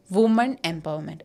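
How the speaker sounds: mock the wrong woman empowerment. Shown as a demonstration. Teacher mocking the common mistake in debates.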